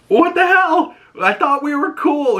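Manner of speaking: acted-out teen yelping in protest; indignant protest. Retold teen protesting being shoved.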